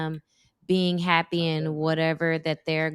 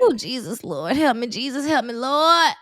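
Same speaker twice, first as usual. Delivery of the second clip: mock plea to God; 4 semitones higher, 4 dB louder than usual. Dramatic plea before telling story.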